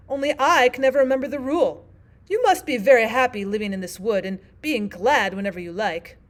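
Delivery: loud, emphatic storybook Queen; Queen character. Acted. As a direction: address Alice as the Queen.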